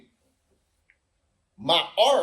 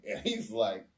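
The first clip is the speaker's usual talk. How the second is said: ordinary talk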